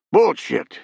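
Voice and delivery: high-pitched outraged exclamation; exclaimed. A narrator's reading. Bruce rejects the president's excuse, high outraged exclamation. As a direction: blurt it out in disbelief. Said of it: "Bruce exclaimed".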